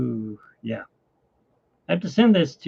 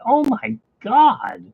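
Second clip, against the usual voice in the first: amazed gasp; 10 semitones higher, 3 dB louder, much slower than usual. Retold or imitated. Reacting to a winning scratch ticket.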